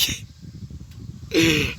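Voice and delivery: breathy, near-silent laughter; real wheezy chuckle; wheezing laugh. Real, not acted. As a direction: crack up and run out of air. Laughs at own description.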